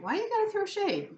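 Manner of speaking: high-pitched mock complaint; imitated questioner. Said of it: "people asking me".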